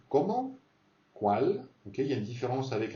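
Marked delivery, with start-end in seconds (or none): 0.1-0.6 Comment climbing high at the end; rising question tune. Shown as a demonstration. Teacher repeating an accented question word, Comment rising high. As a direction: send Comment up into the highs. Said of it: "pareil, il y a un accent".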